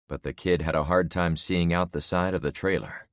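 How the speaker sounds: ordinary delivery, nothing marked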